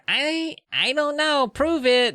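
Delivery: high, drawn-out nasal doubt; Lauren. Acted. Friend doubts the bragging kids, high nasal challenge. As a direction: whine it sceptically, high in the nose.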